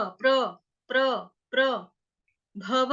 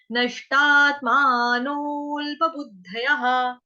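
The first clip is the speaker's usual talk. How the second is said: slow recitation; 4 dB louder, much slower than usual. Shown as a demonstration. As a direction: chant the Sanskrit line slowly, syllable by syllable. Teacher chants verse slowly. (धीमा श्लोक पाठ)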